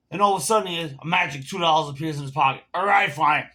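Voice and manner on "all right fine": loud mock surrender; exasperated giving in. Customer finally paying the full price, exasperated giving in. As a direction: imitate a loud, grudging concession.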